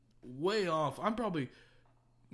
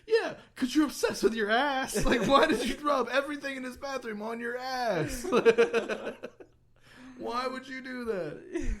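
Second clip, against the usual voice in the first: high, loud retold rant; mock exasperated outburst; 9 semitones higher, 8 dB louder than usual. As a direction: argue back loudly, half laughing. Acted retold argument, exasperated friend.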